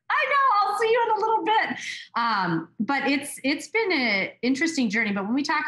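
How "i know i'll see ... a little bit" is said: very high, fast chirpy reply; cheery fake nonchalance after getting caught; breezy cover-up. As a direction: sound totally on top of it, chirping. Retold breezy reply after a laugh.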